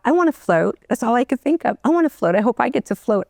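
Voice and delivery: put-on high, eager little-girl voice wishing to float; childlike wish. Remembering her childhood wish to float, high and eager.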